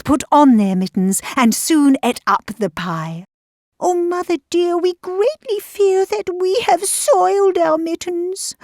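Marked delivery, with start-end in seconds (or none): 3.8-8.6 high little kitten voice; high-pitched worried kittens; confessing kittens. A narrator's reading. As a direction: confess to mother in a small high voice. Kittens confess to mother cat.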